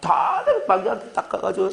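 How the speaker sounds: ordinary talk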